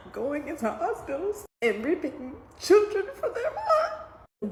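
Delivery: high, slow, real tearful voice; breaking into tears. Real, not acted. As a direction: let crying overtake the sentence. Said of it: "I'm trying not to cry".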